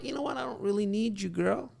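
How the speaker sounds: high, breezy, offhand brush-off; aloof guy. Retold or imitated. Coach playing a guy brushing off a girl, breezy and aloof.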